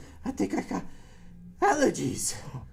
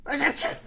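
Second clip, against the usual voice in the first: sneeze; 8 semitones higher, 3 dB louder than usual. Pretend. Dad pretends to keep sneezing.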